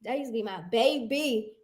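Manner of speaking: low, soft and tender; swooning. Real, not acted. Host swooning softly over her favorite rapper. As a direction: melt over your celebrity crush.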